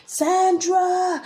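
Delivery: high, drawn-out name call; imitated brother calling. Imitating brother's voice calling the birth name, high drawn-out call. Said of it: "And my brother is like".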